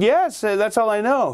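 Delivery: put-on high, timid little boy voice; a nervous kid owning up; frightened boy. A boy answering his dad, high and timid.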